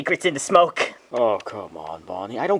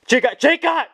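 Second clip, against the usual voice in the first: shouting over her; 11 semitones higher, 4 dB louder than usual. Acted. Freddy cuts in, louder.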